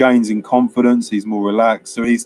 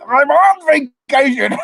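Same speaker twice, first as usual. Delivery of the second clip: high, loud mock yell; hammed-up, high-pitched retort; exasperated outburst; 4 dB louder than usual. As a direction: yelp it back in exasperation. Acting out exasperated retort in film.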